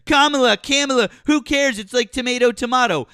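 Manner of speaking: high, loud dismissive mimicry of him; mock his careless brush-off; mocking a friend's dismissive words, high and loud; mocking imitation. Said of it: "you were like".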